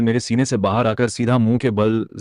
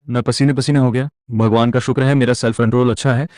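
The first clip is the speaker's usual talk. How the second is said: ordinary delivery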